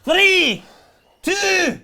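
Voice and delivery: high, loud gladiator countdown bellow. Acted. Host starting the quick fire round, bellowed gladiator voice. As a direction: belt out each word like the Gladiators referee. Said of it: "in the traditional gladiator voice".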